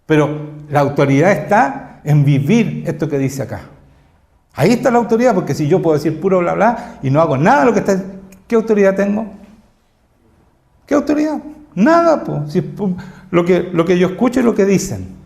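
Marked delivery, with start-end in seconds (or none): 4.5-15.2 loud, raised, emphatic preacher's voice; preaching at full force. Acted. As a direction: drive each claim home to the congregation.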